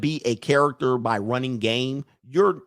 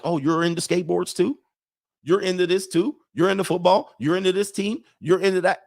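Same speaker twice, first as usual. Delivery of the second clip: higher, rising questions of happy discovery; upbeat mock surprise, each question lifting; imitated eager interest; 5 semitones higher than usual. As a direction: play fellow fans finding common ground. Voicing delighted fellow hobbyists.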